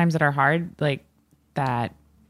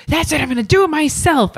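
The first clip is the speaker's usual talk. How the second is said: high, raised-voice exasperation; re-enacted fit; 11 semitones higher, 4 dB louder than usual. Diner storming into the kitchen, mock fit. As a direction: storm in, fed up, acting out a tantrum. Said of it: "I would throw a fit".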